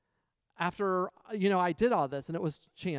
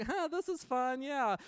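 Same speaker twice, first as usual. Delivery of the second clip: mock enthusiasm; 8 semitones higher, slower than usual. Faking enjoyment at slot machine.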